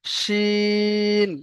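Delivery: শিন held long with a heavy hushing sh; drawn-out shin. Shown as a demonstration. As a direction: stretch শিন, pressing it. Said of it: "বুঝতে পেরেছেন?". (চেপে মোটা টানে শিন)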